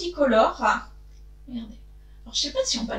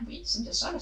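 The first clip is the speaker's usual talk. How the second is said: breathy whispered aside to herself; 7 dB quieter, faster than usual. Real, not acted. Vlogger confirming to herself which week, whispered aside. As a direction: drop to a whisper to check the date.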